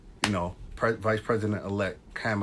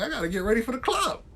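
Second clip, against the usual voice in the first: excited retelling; 3 dB louder, faster than usual. Retold or imitated. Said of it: "I was like".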